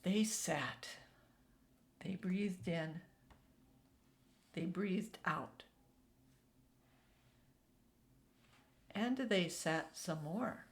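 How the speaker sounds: soft, slow and hushed; calm slow. A narrator's reading. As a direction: settle into stillness. Narrator slows into stillness.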